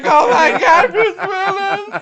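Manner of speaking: very high, loud hammed-up wail; over-the-top tearful squeal; mock bawling. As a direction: bawl it out, stretching the name. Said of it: "And I'm just like".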